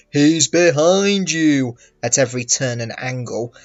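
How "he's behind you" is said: loud, drawn-out higher cry; pantomime audience scream. Acted. Audience warning the hero at a panto, drawn-out mock scream. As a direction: yell it slowly like a panto crowd. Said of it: "encouraged to scream".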